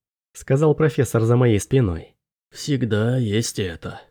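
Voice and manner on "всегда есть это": low, soft, very slow professor; resigned professor. A narrator's reading. Professor offers a last resort, low and slow. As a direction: offer it slowly, low and subdued.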